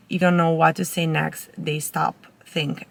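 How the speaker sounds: ordinary talk, nothing marked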